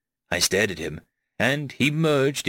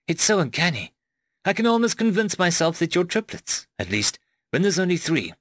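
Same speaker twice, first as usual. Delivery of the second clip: higher, lightly voiced bemused companion; unsettled Rose; 6 semitones higher than usual. A narrator's reading. Rose talks to the narrator about his clones, mildly unsettled. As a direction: marvel at it in a lightly raised voice.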